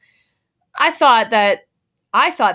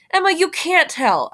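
pleading; 7 semitones higher than usual. A narrator's reading. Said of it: "I pleaded".

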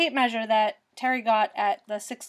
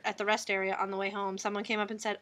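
normal talking voice

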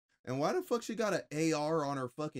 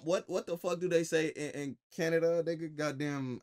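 usual speaking voice

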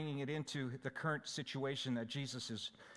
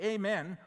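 "Amen" said louder and higher, falling firmly to the end; emphatic Amen; 4 semitones higher, 5 dB louder than usual. Shown as a demonstration. Scene: pastor showing how Jesus opens with "Amen", emphatic. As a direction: punch "Amen" like a call to attention. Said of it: "He says it this way".

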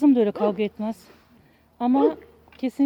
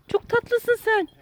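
cooing to a dog; 10 semitones higher, much slower than usual. Real, not acted. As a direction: melt over the dog, drawn out. (köpeğe yüksek ve yavaş sevgiyle seslenme)

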